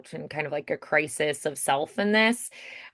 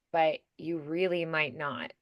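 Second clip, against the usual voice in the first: soft, sad, sinking into helplessness; quietly devastated, letting the voice fall; deeply saddened; 4 semitones lower, 9 dB quieter than usual. Shown as a demonstration. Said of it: "really deeply saddened".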